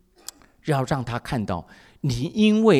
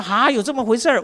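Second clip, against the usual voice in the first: 1 startled; 8 semitones higher, 6 dB louder than usual. Retold or imitated. Pastor's startled reply.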